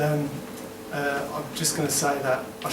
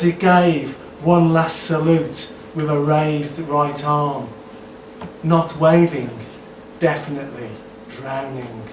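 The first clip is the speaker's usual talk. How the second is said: measured spoken-word poem delivery; performed political poem with punched phrases; poetry recital; 3 semitones higher, 8 dB louder than usual. Acted. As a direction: recite verse in deliberate, spaced phrases.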